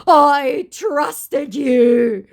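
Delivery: very slow, high, loud mock betrayal; milk the wounded feelings; hammed-up betrayed declaration. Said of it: "declared my absolutely betrayed scammer".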